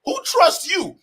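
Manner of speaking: high, loud, cracking with laughter; laughing outrage. Real, not acted. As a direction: yell it while breaking into laughter.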